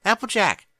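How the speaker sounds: high call through a closed door. Acted. Twilight calling Applejack from behind the bathroom door, loud high call. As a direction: call her name from the shower, loud.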